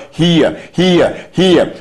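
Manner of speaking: "hear, hear, hear" each hear given its own weight; stressed repetition. Shown as a demonstration. Preacher showing the church the word "hear", stressed each time. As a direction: lean on every "hear" one by one. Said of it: "He emphasizes the word".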